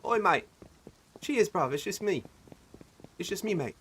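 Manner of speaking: high-pitched put-on English accent. Gamer greeting others as a harmless mate, English accent. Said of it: "switch to my, uh, English, English accent".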